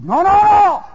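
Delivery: high, shouted denial; loud protest. Acted. Preacher acting Brother Ed arguing back, loud protest. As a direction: protest at the top of the voice.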